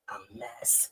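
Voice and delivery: hushed, breathy aside; whispering a gossipy verdict; whispered aside. Real, not acted. Gossip aside after rebuke.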